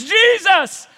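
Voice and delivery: loud, high pleading cry; a blind beggar crying out to Jesus. Acted. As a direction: call out desperately for mercy. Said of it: "he begins to cry out".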